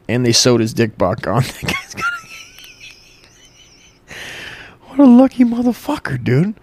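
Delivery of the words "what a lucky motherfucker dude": laughing. Real, not acted.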